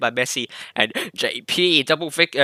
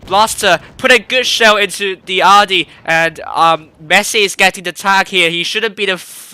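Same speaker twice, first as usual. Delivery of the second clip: louder, higher fast-paced commentary; excited play-by-play; 5 semitones higher, 5 dB louder than usual. Real, not acted. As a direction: call the rush with rising excitement. Live play-by-play heating up.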